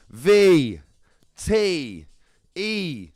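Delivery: high, stretched-out letter-by-letter call; drawn-out countdown. Real, not acted. Host counting down to the draw on V tech, drawn-out call. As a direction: call each letter out long, building to the final word.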